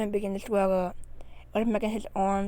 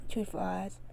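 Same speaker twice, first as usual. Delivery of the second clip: plain reading voice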